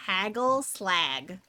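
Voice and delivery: Canadian accent, slow Haggle slag, hard g. Shown as a demonstration. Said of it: "in a Canadian accent".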